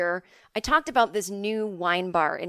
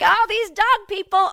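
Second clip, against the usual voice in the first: high, loud, slow mock groaning complaint; hammed-up disgust at a whole crowd; exasperated; 12 semitones higher, 8 dB louder than usual. Daughter joining mom's complaint about dog owners, exasperated.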